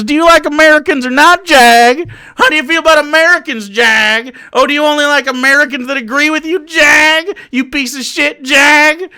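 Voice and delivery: angry taunting. Real, not acted. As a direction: badger them, punching the name loudly. Haranguing the viewer by name.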